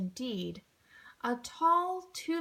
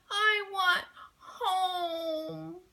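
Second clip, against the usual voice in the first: very slow, high tearful plea; crying; 6 semitones higher, much slower than usual. A narrator's reading. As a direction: sob it out. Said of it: "she wailed".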